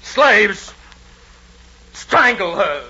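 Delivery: loud, high barked order; furious command. Acted. Nero orders his slaves to strangle his mother, furious.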